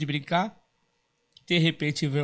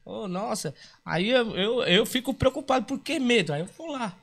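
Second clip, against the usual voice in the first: raised pitch, puzzled why she fears him; wondering aloud, pitched up; high worried telling; 7 semitones higher than usual. Retold or imitated. Dwarf puzzled why the girl fears him, higher voice. Retelling his worry, voice high.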